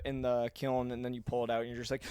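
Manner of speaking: ordinary delivery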